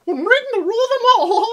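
very high, squeaky cartoon mouse voice; Mickey Mouse voice. Friends guessing voices, high squeaky Mickey Mouse impression. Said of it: "Mickey Mouse".

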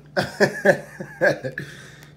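high, breathy run of laughter; helpless laugh. Real, not acted. Opener begging the pack for luck, helpless laugh. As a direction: burst out laughing in frustration.

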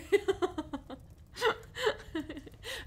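high, gasping laughter; giddy laughing. Acted. Teasing partner they're not the only reader, giddy laughing. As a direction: laugh high until out of breath.

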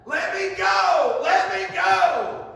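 very high, loud, slow scream; drawn-out desperate yell of the struggling boy. Retold or imitated. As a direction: scream to be set free. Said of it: "That boy keeps screaming".

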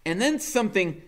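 usual speaking voice

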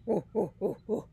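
ape ooh-ooh hooting; gorilla hoots. Acted. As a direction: hoot like a gorilla. Gorilla noises.

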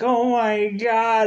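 high, slow moan of pain; pained groan. Retold or imitated. David transforming into a werewolf, high pained moan. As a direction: drag it out, hurting. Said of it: "he's like".

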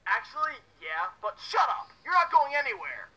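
loud, high, forceful threat; confrontational shout. Acted. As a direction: yell to stop them escaping. Villain confronts the intruder.

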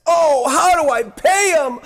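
a touch of anxious despair; mock worried. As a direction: play someone panicking about money. Voicing a worried patient.

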